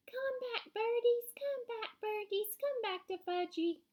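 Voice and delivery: put-on high-pitched calling, little-boy voice; screaming toddler. A narrator's reading. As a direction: cry out after the birds. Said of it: "was screaming".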